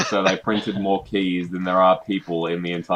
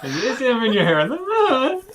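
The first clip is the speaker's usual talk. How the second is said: much higher, laughing squeaky voice; squeaky voice break. Acted. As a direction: squeak it out like puberty hit. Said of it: "Yes, it is a little bit".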